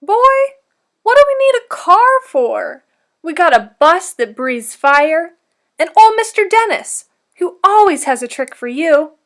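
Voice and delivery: high, loud, cheerful grandmother; lively Nana voice. Acted. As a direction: boom it out like a jolly grandma. Nana's lively reply.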